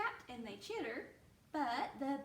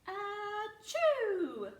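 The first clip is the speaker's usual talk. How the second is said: a big, high, loud mock sneeze; 10 semitones higher, 8 dB louder than usual. Teacher acting the bear's sneeze for the kids, loud and playful. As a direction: sneeze it out like the bear.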